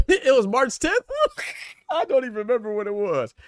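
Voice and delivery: loud laughter through the words; cracking up while asking; laughing. Real, not acted. Reading a comment, cracking up.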